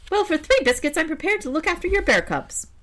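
very high crow voice. Acted. Crow eyes the bear's sack, very high. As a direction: squeak it like a nosy crow.

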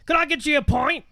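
high-pitched hammed-up British accent; British bartender. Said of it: "pretending to be the bartender".

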